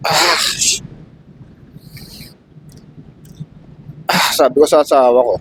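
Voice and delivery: crying through the words; tearful. Real, not acted. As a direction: voice breaking, fading to a whisper. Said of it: "naiiyak ako".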